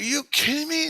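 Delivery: high, disbelieving retold cry; incredulous. Storyteller reacting to the native guy, incredulous. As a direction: fling it out in high-pitched amazement.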